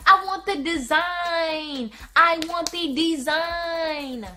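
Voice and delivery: slow, firm I want the design; insisting slowly. Retold or imitated. Customer repeating her demand to the nail tech, slow and firm. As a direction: drag it out, demanding. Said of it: "I'm like".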